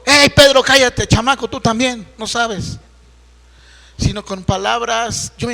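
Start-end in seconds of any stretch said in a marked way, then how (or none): 0.0-2.8 loud, rough telling-off of Pedro; harsh rebuke. Retold or imitated. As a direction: bark it at Pedro like a scolding. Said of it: "No regañó a Pedro y le dijo". (regaño fuerte y brusco a Pedro)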